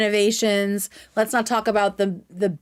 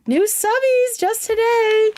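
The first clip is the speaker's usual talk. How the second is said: much higher, stretched out, louder; excited squeal; 13 semitones higher, 4 dB louder than usual. Real, not acted. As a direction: gush over the new subbies. Excited about new subscriber count.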